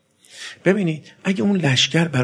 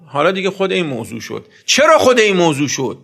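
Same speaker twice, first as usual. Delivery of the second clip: ordinary talk, nothing marked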